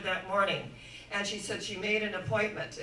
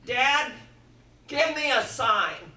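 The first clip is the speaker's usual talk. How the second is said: loud, slow call to a father above; praying aloud to Dad for a sign, raised and pleading; 3 semitones higher, 7 dB louder, slower than usual. Shown as a demonstration. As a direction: cry out for a sign. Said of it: "When we need to call out".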